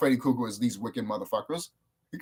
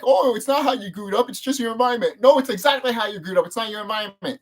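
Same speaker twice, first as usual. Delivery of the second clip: higher-pitched imitation of a squabble; mock arguing; 7 semitones higher, 4 dB louder than usual. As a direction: act out two debaters contradicting each other. Acts out both sides of debate.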